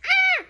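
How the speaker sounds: high loud shriek of delight; loud excited squeal. Real, not acted. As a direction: shriek out at the page. Excited at picture.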